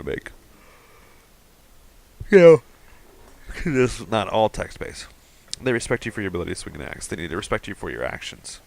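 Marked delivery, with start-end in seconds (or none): 2.3-2.6 sudden loud outburst. Real, not acted. Breaks in on his own reading. 5.6-7.1 put-on "They respect you for your ability to swing an axe" rattled off fast, like old text game prompts; rushed reread. Shown as a demonstration. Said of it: "you know what this kinda reminds me of".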